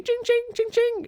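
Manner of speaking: very high sung chings like a jackpot; slot machine ching. Pretend. Mimicking a slot machine hitting the jackpot, high and bright. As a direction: ring out like a slot machine paying out.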